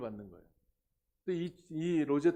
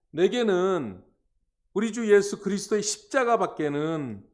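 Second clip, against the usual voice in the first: loud, raised preaching voice; scripture declamation; 6 semitones higher, 10 dB louder than usual. Acted. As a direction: proclaim the verse at full force. Declaims the apostle's words from scripture. (성경 말씀을 힘주어 크게 선포하는 설교조)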